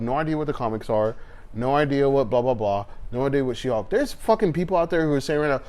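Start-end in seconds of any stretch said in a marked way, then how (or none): 3.9-5.6 heated rebuttal. Real, not acted. Mocking fans then rebutting.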